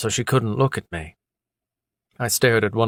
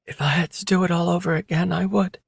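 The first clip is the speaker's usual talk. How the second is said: quick, higher, resolute woman; a woman refusing to back down; woman's voice; 8 semitones higher, faster than usual. A narrator's reading. Female character voiced by narrator.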